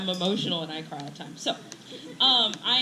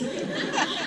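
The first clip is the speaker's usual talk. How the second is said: high laugh. Real, not acted. Teacher laughing about the pre-calculus help, a high burst.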